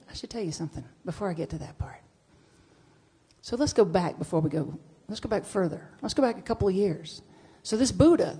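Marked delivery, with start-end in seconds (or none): 0.1-2.0 hushed aside to the audience; whisper. Real, not acted. Speaker pausing her story to whisper an aside. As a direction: drop to a whisper like sharing a secret.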